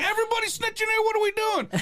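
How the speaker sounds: high, fast comic rant; acted frantic outrage. Inmate complaining everybody is snitching, acted frantic outrage. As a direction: rush it out in high-pitched mock exasperation.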